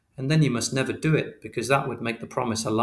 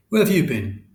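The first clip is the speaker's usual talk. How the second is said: stern question; 5 semitones higher, 6 dB louder than usual. Acted. Father confronts son at home.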